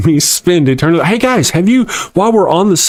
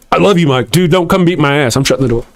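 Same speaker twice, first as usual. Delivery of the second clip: ordinary delivery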